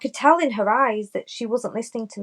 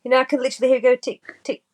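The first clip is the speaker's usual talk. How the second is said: normal talking voice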